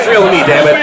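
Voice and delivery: mock outraged fan. Wrestling fan catchphrase bit.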